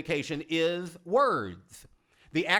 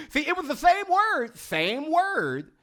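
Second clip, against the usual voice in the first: excited; 10 semitones higher, 4 dB louder, slower than usual. Real, not acted. Excited after the audience exercise.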